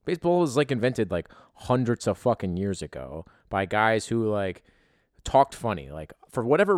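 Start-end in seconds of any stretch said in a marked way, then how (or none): none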